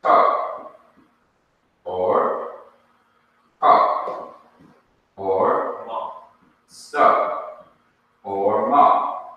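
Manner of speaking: each syllable clipped with a short O vowel; short O examples. Shown as a demonstration. Teacher sounding out Hebrew syllables for students, short O demo. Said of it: "It's your short O sound".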